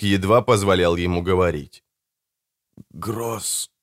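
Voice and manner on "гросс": very soft, laboured whisper; broken whisper. A narrator's reading. Dying Bertram names the brothers, faint broken whisper. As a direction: barely get the word out through smashed lips. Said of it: "прошептал он разбитыми губами".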